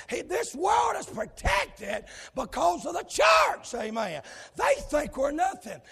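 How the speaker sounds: loud, driving revival preaching; fiery preaching. Acted. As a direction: preach it hard to the church. Preaching at full force.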